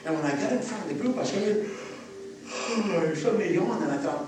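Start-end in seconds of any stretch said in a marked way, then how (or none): 1.7-2.5 long unvoiced sigh of relief; demo sigh of relief. Shown as a demonstration. Teacher to seals, a long relieved sigh. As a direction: let the breath out in relief. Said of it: "took a sigh of relief".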